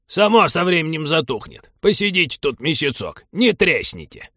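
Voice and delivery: high, crotchety grandpa refusal; high-pitched combative geezer; belligerent old man. A narrator's reading. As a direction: brush off pleas as a stubborn old codger. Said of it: "воинственно отрезал дед".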